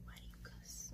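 whispered mock mom scolding; imitating mom. Imagining mom scolding her cussing, whispered. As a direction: breathe the scolding like a hushed mother. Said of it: "She's probably like".

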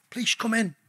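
ordinary talk, nothing marked

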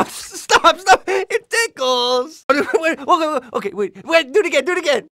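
high, helpless laughing protest; tickled, high-pitched pleading; ticklish giggling. Acted. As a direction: squeal and giggle through the words. Hero being tickled.

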